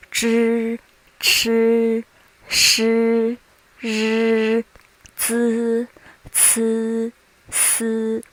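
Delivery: Zhi, chi, shi, ri, zi, ci, si with the consonant sound drawn out; extended initials. Shown as a demonstration. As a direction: hold each of Zhi, chi, shi, ri, zi, ci, si level, with no "ee" vowel. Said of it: "just extend the pronunciation of the initials".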